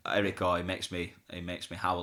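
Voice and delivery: ordinary talk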